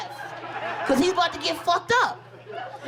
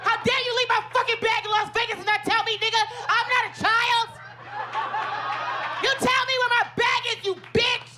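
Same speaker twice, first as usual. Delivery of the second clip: hammed-up angry tirade; furious rant; 6 semitones higher than usual. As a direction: pretend furious outburst, higher and fast. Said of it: "I wanted to go off".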